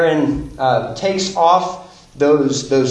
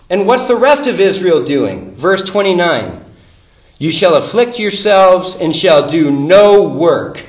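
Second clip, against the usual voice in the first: louder and higher, driving each line home; preaching at full force; 4 semitones higher, 7 dB louder than usual. Acted. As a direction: preach it like the climax of the sermon.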